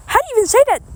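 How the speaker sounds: high, loud, baffled squeak at the word; exasperated. Real, not acted. Weather streamer stuck on a town name, exasperated high cry. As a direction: throw up your hands at the name.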